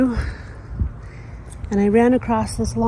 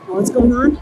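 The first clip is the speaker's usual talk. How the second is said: ordinary delivery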